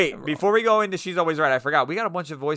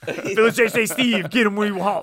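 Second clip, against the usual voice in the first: higher, laughing mock commercial read; radio ad voice; 5 semitones higher, 3 dB louder than usual. Joking about a restaurant ad, mock radio announcer. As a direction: pitch it like a cheesy radio ad. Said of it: "intro to a really good radio commercial".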